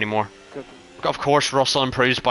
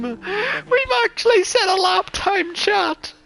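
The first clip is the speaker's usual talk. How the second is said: near-tears ecstatic screaming. Real, not acted. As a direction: scream in disbelief, on the verge of crying. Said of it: "I'm gonna cry".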